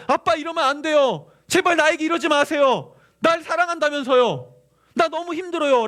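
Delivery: loud, high-pitched appeal to dad; child pleading insistently. Acted. Child coming to father to complain, pleading. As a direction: beg and protest at full voice. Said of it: "자녀는 떼쓸 수 있어".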